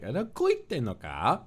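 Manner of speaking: high, slow, trilling mock line; imitating the trill in "Ada koite no ka?"; imitating Kotoka's trill. Imitating a teammate's trill, high and slow. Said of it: "the kind of trill that Kotoka has in her voice".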